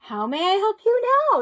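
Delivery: a bright, brisk shapeshifter voice. Acted. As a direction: chirp it out like a busy magician.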